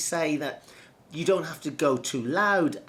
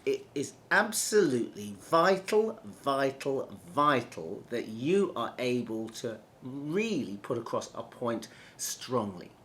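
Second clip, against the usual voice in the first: vital, vital, vital, each hit with weight; repeated for emphasis. Shown as a demonstration. Coach demonstrating repetition, emphatic. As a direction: hammer the word vital three times. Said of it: "I'm repeating words".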